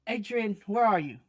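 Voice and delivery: loud call from a distance. Acted. Father calling for Adrian from afar, loud. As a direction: call out to a missing kid. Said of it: "another voice kind of yell".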